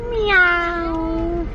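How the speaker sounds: high, drawn-out meow; cat meow. Shown as a demonstration. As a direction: mew like a cat for a toddler. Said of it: "A cat?".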